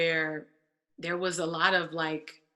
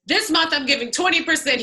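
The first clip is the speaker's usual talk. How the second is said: bold announcement; 8 semitones higher, 8 dB louder, faster than usual. Retold or imitated. Retold bold announcement to manager.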